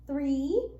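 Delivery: normal talking voice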